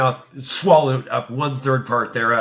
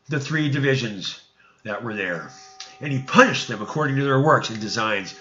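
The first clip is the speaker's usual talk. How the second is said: ordinary delivery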